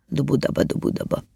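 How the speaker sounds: soft rhythmic dubudaba; quiet galloping patter, fading away; imitated hoofbeats. A narrator's reading. Horses trotting with the cart, soft imitated hoofbeats.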